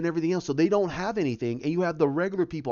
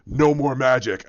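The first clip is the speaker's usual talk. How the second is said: loud, slow, lowered brute voice; menacing Hulk voice; 4 semitones lower, 6 dB louder, slower than usual. Acted. Hulk grabs the sorcerer's hand, slow menacing growl. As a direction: growl it slowly like an angry giant.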